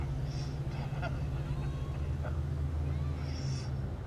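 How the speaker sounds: high breathy giggle; squeaky giggle. Real, not acted. Player giggling high after the squeaky bit. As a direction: let a high giggle slip out.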